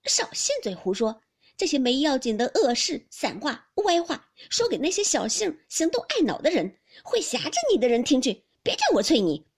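loud, indignant telling-off; angry scolding. A narrator's reading. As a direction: scold him hard and cut him off. 湘云怒斥宝玉. (气愤地大声斥责)

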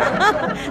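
short bright laugh at the guest; amused laugh. Real, not acted. Host laughs at old man. (被逗乐地笑)

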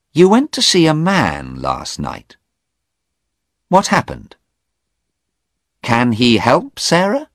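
firm woman at the door; urgent Anna. Acted. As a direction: get him to open up now. Anna questioning.

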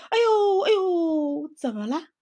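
loud, high cries of burning pain; tiger in pain. A narrator's reading. Tiger bites the chili eye, yelping in pain. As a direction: yelp as the chili burns.

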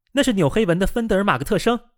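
high-pitched excited announcement; calling out. A narrator's reading. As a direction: call out on spotting an acquaintance. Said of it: "见了熟人，他喊道".

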